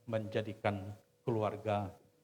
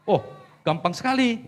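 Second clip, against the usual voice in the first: high, loud scoffing retort; mock scoff; 10 semitones higher, 12 dB louder than usual. Young wife scoffing at the pastor, high and loud. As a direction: toss it off as if it were nothing. Wife's mocking reply.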